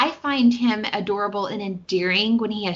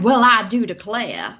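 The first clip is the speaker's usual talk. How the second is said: mock Southern accent; 5 dB louder than usual. Mocking films that try to be Southern, exaggerated Southern accent. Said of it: "for them to be Southern".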